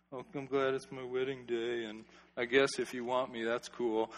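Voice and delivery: mumbled, soft, lifeless bride; slouching bride. Acted. Preacher mocks a listless bride, soft and limp. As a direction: play the bride with no self-respect, muttered. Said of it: "She's not slouching like this".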